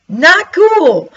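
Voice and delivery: a comic high-pitched complaint; mock whine. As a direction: squeak it out in playful protest. Mock reaction to slow progress.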